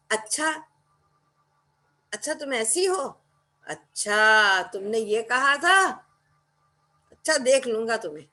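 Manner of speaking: "अच्छा" rising and sarcastic, building to a threat; each "अच्छा" a fresh shade, ending in menace; varied sarcastic achha. Shown as a demonstration. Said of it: "एक अच्छा में देखिए कि कितने ज्यादा भाव".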